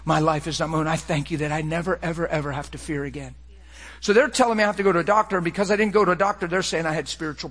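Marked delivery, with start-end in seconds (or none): 0.0-3.4 soft, low prayerful voice; hushed prayer to the Holy Spirit; quiet prayer. Acted. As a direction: pray it gently under the breath.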